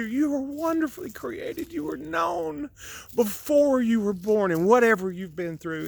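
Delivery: heartfelt sermon lifting in pitch; preachy. Real, not acted. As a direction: preach it with rising fervor. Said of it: "I got a little preachy".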